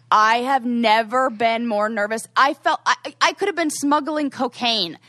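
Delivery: high, loud emphatic storytelling; worked-up exclamation. Real, not acted. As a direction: punch it out, still rattled by the memory. Recalling peak nerves at the airport.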